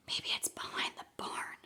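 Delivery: unvoiced sneaky whisper; whispered Papa, creeping through the field. A narrator's reading. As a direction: hush it so the farmer can't hear. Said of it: "whispered Papa".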